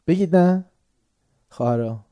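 usual speaking voice

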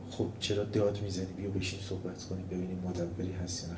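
soft, unhurried and low; very calm. Retold or imitated. Ibn Abi al-Awja calmly answering the shouting student, soft. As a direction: calmly invite him to sit and talk. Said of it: "خیلی آرام".

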